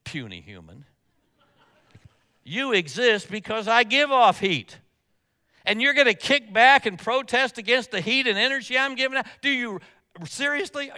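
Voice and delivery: mock booming sun. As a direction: talk down like a mighty sun. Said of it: "If the sun could talk".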